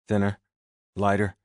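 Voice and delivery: ordinary delivery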